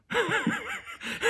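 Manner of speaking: high squealing burst of laughter; a stunned, delighted shriek of a laugh; shocked laugh. Real, not acted. Hearing sweet Beth swear, shocked squealing laugh. Reacting to counselor's blunt swear.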